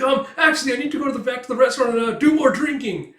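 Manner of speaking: mock flustered escape, fast and shrill-high; freaking out. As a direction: rush out an excuse in total panic. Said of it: "as she starts freaking out".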